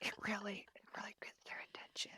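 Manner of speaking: very soft, voiceless whisper. Shown as a demonstration. Mom explaining how whispering gets kids' attention, whispered. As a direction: keep it whispered, barely there. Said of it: "I've been whispering".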